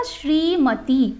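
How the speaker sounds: श्रीमती drawn out, very slow; slow careful pronunciation. Shown as a demonstration. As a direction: spell out श्रीमती sound by sound. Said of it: "यहाँ पे शुद्ध रूप क्या हो जाएगा".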